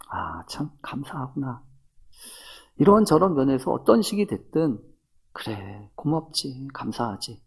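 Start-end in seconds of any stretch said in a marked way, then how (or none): 0.1-1.6 very soft, whispered musing; hushed gratitude. Acted. Voices grateful inner reflection. 5.3-7.4 very soft, as if to yourself; quiet grateful musing. Acted. Voices grateful inner reflection.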